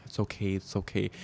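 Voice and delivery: soft self-comfort, hushed; soothing herself. Retold or imitated. Patting herself to calm down, softly soothing. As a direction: hush it gently like patting a child. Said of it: "start to pat myself and be like".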